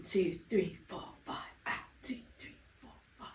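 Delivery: very soft, breath-only counting; whispered count. Shown as a demonstration. Pilates instructor counting the held curl, whispered. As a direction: count on the breath, barely any voice.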